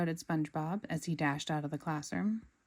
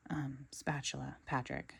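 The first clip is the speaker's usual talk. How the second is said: lower, softer gentle correction; gently; 4 semitones lower, 8 dB quieter than usual. A narrator's reading. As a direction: correct a friend kindly. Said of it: "said SpongeBob gently".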